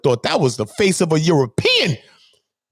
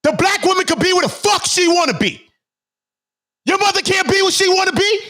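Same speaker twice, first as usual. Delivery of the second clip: high-pitched, indignant yelling; real outrage; 12 semitones higher than usual. Real, not acted. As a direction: throw each line out high in indignation, pausing between.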